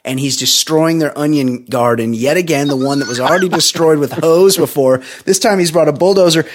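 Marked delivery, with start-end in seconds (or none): none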